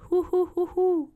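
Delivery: high hooting owl call in rhythm; barred owl call. Shown as a demonstration. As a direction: hoot like a barred owl calling. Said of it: "they do the phrase, "Who cooks for you?".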